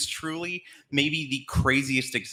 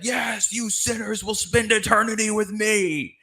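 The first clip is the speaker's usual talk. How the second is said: a much higher, hammed-up devil taunt; diabolical devil; 8 semitones higher than usual. Rubber-masked devil taunts the sinners in hell, hammed-up gloat. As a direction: gloat like a community-theater devil. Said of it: "some kind of diabolical speech".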